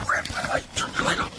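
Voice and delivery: tense whisper. Real, not acted. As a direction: hush so nothing hears. Hiding in the dark woods.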